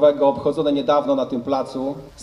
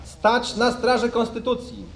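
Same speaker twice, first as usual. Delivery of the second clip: formal oath reading; 4 semitones higher than usual. Real, not acted. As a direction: dictate the oath solemnly for troops. Officer reads oath for repeating. (uroczyste odczytywanie przysięgi)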